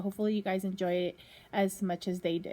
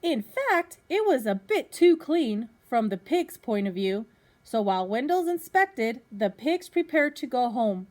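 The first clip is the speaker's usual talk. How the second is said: lively storybook reading to a class; reading to children; 5 semitones higher, 8 dB louder, slower than usual. Acted. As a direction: read it out like a teacher at story time. Teacher reads picture book to class.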